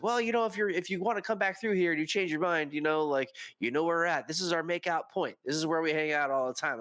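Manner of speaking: high-pitched, casual coaxing in mimicry; suggestive invitation. Couple to the departing conductor at makeout point, suggestive invitation. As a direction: keep the proposition open, lightly and coyly. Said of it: "you can hear the tone".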